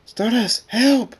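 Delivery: high, loud frightened call; panicked cry for help. Acted. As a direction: yell for rescue in a panic. Frightened character calls for help.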